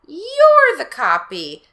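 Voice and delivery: high, loud, very slow accusation; accusing. Acted. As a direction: throw it back at him.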